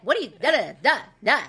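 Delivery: high, loud mock nagging; old yelling voice. Woman mimicking her horrible old voice, loud and high. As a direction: bark nonsense syllables like an old argument. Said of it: "It was horrible".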